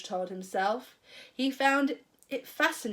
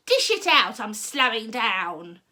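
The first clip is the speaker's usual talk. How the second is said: put-on old grandma's demand; 6 dB louder than usual. A narrator's reading. Grandma demanding George dish it out. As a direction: bark it like a cranky crone.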